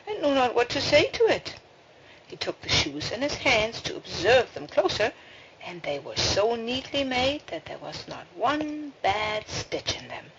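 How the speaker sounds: ordinary delivery, nothing marked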